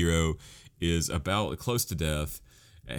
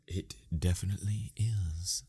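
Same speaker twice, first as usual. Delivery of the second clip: whisper; 5 dB quieter than usual. Acted. Conspiratorial aside about Crunchyroll.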